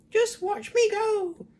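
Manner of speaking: put-on very high, slow, loud screeching old woman; screechy crone shout. Grandma screeching at George to watch her grow, gleeful. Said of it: "she called down to George".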